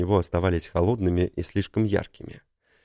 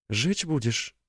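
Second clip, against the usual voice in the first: put-on higher, softer girl's voice; young woman voice; 5 semitones higher, 4 dB quieter, slower than usual. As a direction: voice Tina, soft and higher. Tina's voiced line, higher soft.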